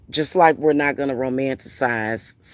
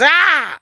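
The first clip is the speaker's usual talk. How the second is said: sudden high mock shriek, louder; 14 semitones higher, 6 dB louder than usual. Lisa yelping back at Idris in the car, mock shriek. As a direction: yelp it out high and sharp. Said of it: "she says".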